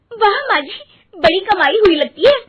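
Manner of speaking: thrilled at the money; excited. Acted. Daughter-in-law to mother-in-law about the day's earnings, delighted.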